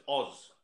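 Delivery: "Oz" with a short rounded o; softly corrective "Oz", ending in z. Shown as a demonstration. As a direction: say "Oz" without drawing out the vowel. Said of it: "you're really prolonging the R. It's just Oz".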